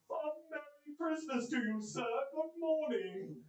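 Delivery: cheery fellows calling a greeting; good-humoured greeting. A narrator's reading. Passers-by greeting Scrooge, lightly voiced cheery fellows. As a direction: hail Scrooge merrily in passing. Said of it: "good-humoured fellows said".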